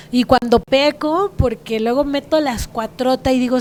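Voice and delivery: usual speaking voice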